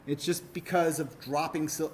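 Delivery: usual speaking voice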